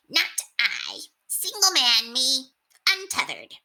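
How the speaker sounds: high proud rat, puffed up; high rat voice, boastful. A narrator's reading. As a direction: strut it like a tiny bachelor. Rat character voice.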